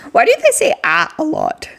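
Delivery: high then low. Shown as a demonstration. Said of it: "repeats it".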